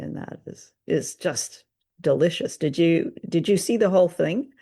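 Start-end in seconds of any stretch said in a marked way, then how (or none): none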